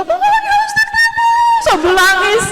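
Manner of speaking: put-on high shrieking teen fan voice; screaming fangirl. Preacher mimics a teen fan crying to the ustaz, high squeal. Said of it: "Ngeliat teriak-teriak".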